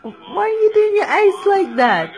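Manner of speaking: ordinary talk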